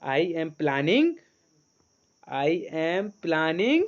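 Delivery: words stretched very slowly; very slow read-aloud. Shown as a demonstration. Teacher reading the sentence slowly for students to repeat. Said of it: "आप खुद set करने की कोशिश करें".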